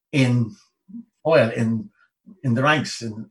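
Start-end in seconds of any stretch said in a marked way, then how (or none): none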